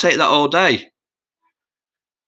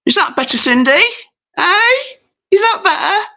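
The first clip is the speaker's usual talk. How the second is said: squeaky high voice. Pretend. Teasing viewer Cindy in a silly high squeaky voice. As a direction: squeak it up to tease her.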